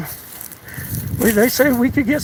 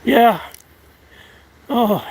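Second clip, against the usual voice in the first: ordinary talk, nothing marked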